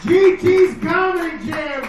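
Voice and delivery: loud, high holler of the show's name; hyped-up comic calling out the show; stage shout. Real, not acted. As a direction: belt it out to the crowd. Comedian hails the show on stage.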